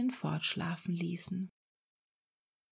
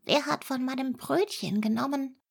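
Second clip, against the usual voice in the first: higher, squeaky little dwarf; third dwarf; 6 semitones higher than usual. Acted. Third dwarf asking who took his roll, higher voice. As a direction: pitch the third dwarf up high and puzzled. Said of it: "Der dritte".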